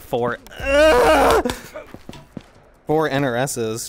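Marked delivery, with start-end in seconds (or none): 0.6-1.5 frustrated cry. Real, not acted. Frustrated streamer in game.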